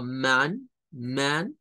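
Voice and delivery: "man" with a vowel between A and U; model pronunciation. Shown as a demonstration. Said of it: "es un sonido que es entre una A y una U". ("man" con vocal entre A y U)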